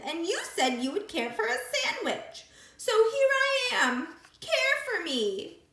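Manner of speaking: high, loud, gloating creature voice; sandwich creature. Acted. Creature bossing the captured piglet. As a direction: gloat over her catch.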